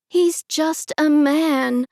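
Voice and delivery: slow, loud, aghast protest; stunned disbelief, spelling it out. Acted. Narrator aghast, telling aunts he's a man, slow and loud. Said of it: "I said, aghast".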